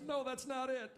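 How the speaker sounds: high-pitched mock mom voice; imitated mom. Mom correcting her son mid-laugh, high imitated voice. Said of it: "She goes".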